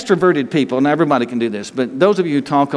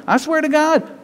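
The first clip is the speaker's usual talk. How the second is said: hammed-up oath, pitched much higher; mock swearing oath; 12 semitones higher than usual. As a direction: imitate Peter's forced oath, high and strained. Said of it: "Now he's swearing".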